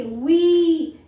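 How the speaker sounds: वी with a drawn-out "ee"; long E demo. Shown as a demonstration. Teacher giving another open word, वी with long E. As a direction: stretch the vowel in वी. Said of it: "ये long ई".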